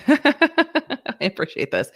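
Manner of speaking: high, slow, laughing through the words. Real, not acted. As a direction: laugh it out, lingering on each word.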